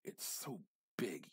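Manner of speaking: ordinary delivery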